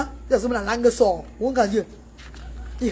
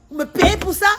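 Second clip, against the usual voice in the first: higher indignant repeat; 7 semitones higher than usual. Acted. Indignant question to government.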